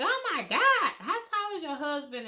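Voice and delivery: high astonished gasp of a nurse; surprised nurse. Retold or imitated. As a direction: sound amazed at the baby's size.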